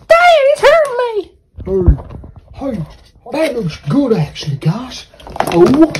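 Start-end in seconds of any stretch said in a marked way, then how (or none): 0.1-1.1 put-on whiny crying kid. Kid character cries out.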